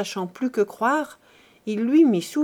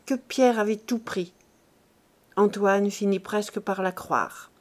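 plain reading voice

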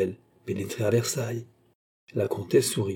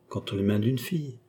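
ordinary delivery, nothing marked

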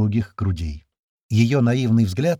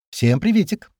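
higher-pitched, bouncy hello; cheery greeting; 8 semitones higher, 3 dB louder than usual. A narrator's reading. Carol surprising the men with a hello, cheery. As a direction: pipe up with a lively greeting.

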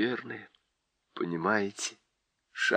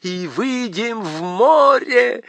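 higher, louder, slow triumphant finish; gleeful faun; 10 semitones higher, 5 dB louder, slower than usual. A narrator's reading. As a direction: finish the plan with joy. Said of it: "закончил фавн и пустился в пляс".

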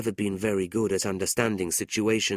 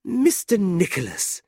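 high, louder glad greeting; happy cry; 9 semitones higher, 5 dB louder than usual. A narrator's reading. Miss La Creevy greets Nicholas at her door, delighted. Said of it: "cried happily".

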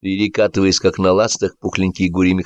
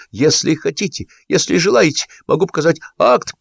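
high, nervous placating patter; flustered, appeasing underling; quick babbling; 9 semitones higher than usual. A narrator's reading. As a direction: babble offers while backing away. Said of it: "быстро залопотал".

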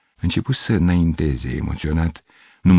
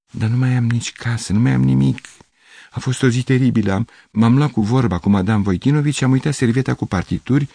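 agitated lament; 6 semitones higher than usual. A narrator's reading.

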